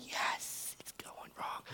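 secret gleeful whisper; hushed mock gloating whisper. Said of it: "then you're going".

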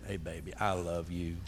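low, soft lover's voice; heartfelt sweet talk. Shown as a demonstration. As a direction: sweet-talk your wife in a deep, hushed tone. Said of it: "Say it like you mean it".

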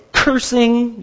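ordinary talk, nothing marked

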